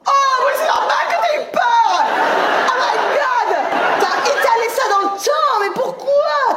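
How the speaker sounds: high, drawn-out outcry; mock shock. Comic to audience woman who got scared, mock-shocked outcry. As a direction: cry out high in exaggerated disbelief.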